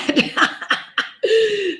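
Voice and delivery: high, gleeful laughter; delighted laugh. Real, not acted. Laughs after retelling windfall.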